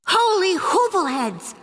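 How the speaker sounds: high, drawn-out alarm call; hollered. A narrator's reading. Skipito as the creatures approach, hollering. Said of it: "hollered Skipito".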